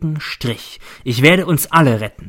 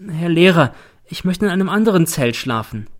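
higher, timid plea; meek; 6 semitones higher than usual. A narrator's reading. Boy N asking the teacher for another tent, meek. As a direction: ask anxiously and small. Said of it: "wendet sich kleinlaut an mich".

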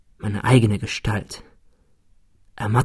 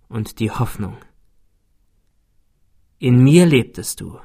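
dying man's last words. Acted. Dying rival speaks last words.

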